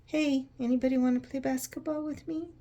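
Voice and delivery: put-on a touch of a lonely boy calling to others; hopeful kid asking. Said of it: "this guy is like".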